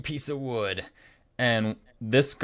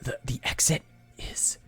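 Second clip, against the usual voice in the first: hushed whisper to the party; 5 dB quieter than usual. Acted. Jasper whispers directions to the escape route. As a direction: keep it secret, barely voiced. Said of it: "he whispers".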